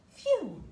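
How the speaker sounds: loud high sigh of relief; relieved phew. Acted. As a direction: blow out in relief. Elves relieved.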